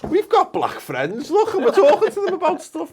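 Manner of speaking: mock defensive.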